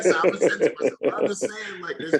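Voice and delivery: laughing through mock-sensitive defense. Real, not acted. Laughing self-defense after joke.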